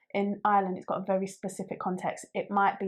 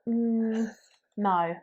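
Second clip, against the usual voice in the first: normal talking voice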